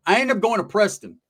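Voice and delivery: ordinary talk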